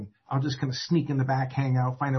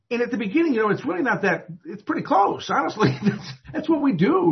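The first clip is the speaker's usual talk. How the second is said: laughing; 10 semitones higher, 5 dB louder than usual. Real, not acted. Laughing admission at AA habits.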